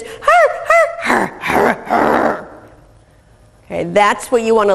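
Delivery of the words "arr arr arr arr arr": hostile dog bark. Shown as a demonstration. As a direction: bark like a dog that means trouble. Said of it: "so much more eliciting versus".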